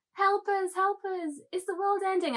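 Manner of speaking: high-pitched mock helpless pleading; pleading crowd. Crowd asking her if world is ending, mock pleading. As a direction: play a helpless little crowd begging for rescue.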